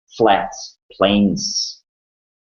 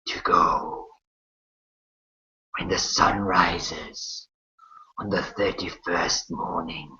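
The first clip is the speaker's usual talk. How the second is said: slow, hushed otherworldly voice, long pauses between phrases; shadowy creature; 3 semitones lower, 6 dB quieter, slower than usual. Acted. Shadow creature reminding you of the contract, slow and whispery. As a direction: whisper it slowly, like a shadow delivering terms.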